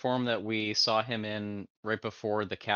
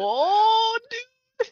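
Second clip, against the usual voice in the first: high, loud cry of delight; a real excited shout; excited yelp; 10 dB louder than usual. Real, not acted. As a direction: whoop at the lucky roll. Dice roll excitement.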